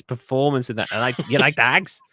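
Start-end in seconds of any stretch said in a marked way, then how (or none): 1.3-2.0 lightly imitated Irish traveller accent. Host quoting Snatch, Irish traveller accent. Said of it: "he played an Irish traveller".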